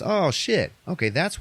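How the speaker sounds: a high, startled oh shit; jumping up in pitch on oh shit; sudden realization. Retold or imitated. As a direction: catch it all at once, mock surprise. Realizing what the blades do.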